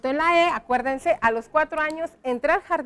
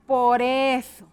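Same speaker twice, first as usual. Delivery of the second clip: "por eso" stretched out slowly; slow dictation; 2 semitones lower, much slower than usual. Shown as a demonstration. Teacher writes the connector for pupils, slow dictation. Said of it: "Entonces vamos a escribir acá".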